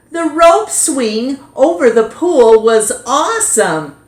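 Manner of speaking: gushing about the best party; excited boy. Acted. JT recalls the party.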